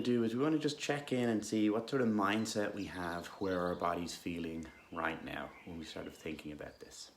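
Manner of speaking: calm, unhurried guidance with long pauses; guided meditation. Real, not acted. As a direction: gently guide listeners into stillness.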